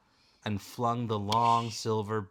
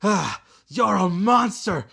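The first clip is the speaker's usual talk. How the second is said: higher, louder gasping cry; gasped cry; 9 semitones higher, 10 dB louder than usual. Acted. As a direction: cry out in pain under her claws. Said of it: "the Ice Wing gasped".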